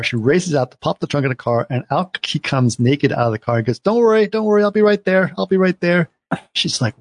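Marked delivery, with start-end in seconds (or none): 3.8-6.1 frantic reassurance. Retold or imitated. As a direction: panicky cover-up, half laughing it off. Naked guy reassures wife from trunk.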